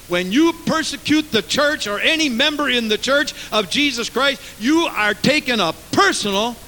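high-pitched, insistent pulpit delivery; forceful preaching. Acted. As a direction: press the charge home.

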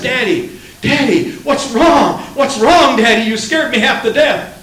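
put-on high, loud, breathless child's plea; scared child clinging to daddy; frightened little girl. Frightened child runs to father.

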